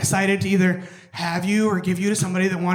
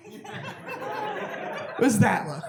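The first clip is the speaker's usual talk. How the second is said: laughing; 11 semitones higher, 5 dB quieter, much slower than usual. Retold or imitated. Imitating a begging dog look.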